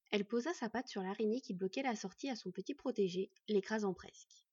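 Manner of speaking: usual speaking voice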